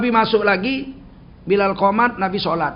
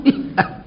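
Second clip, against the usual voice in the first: short laugh; 6 semitones higher than usual. Real, not acted. Laughs at his own joke.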